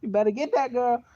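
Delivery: high, squeaky mock voice of cheering classmates; hyped-up friends. Classmates cheering him on as he walks by with his girl, in a high squeaky mock voice. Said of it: "they said".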